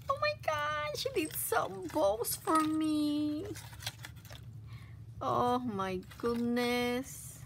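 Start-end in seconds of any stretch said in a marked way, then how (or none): 0.1-3.5 very high squeal of surprise; excited squeal. Real, not acted. Vlogger spotting handmade bows, very high thrilled squeal. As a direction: shriek with sudden delight, far higher.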